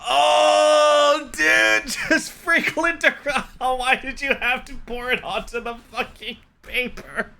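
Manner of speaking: high, wheezing, pained laughter; laughing through pain. Real, not acted. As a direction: laugh so hard it hurts. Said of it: "oh God, my rib hurts".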